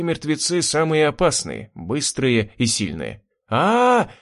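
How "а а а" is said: high straining yell of effort; desperate yell. A narrator's reading. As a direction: yell while shoving with all strength. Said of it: "заорал воробей". (отчаянный крик от натуги)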